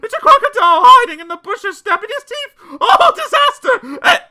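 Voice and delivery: hysterical high, loud wailing; panicked shrieking. A narrator's reading. Mister Jelly fearing a falling tree and crocodile, shrieking. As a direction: scream each fresh imagined disaster in mounting panic. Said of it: "he shrieked".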